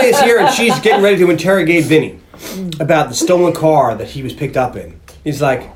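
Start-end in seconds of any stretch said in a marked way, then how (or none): none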